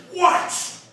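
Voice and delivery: a sharp, high, loud "What?" on waking; rude groggy snap. Retold or imitated. Father woken by a phone call, rude loud snap. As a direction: bark it at the ringing phone. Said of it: "answered it with a rudely spoken".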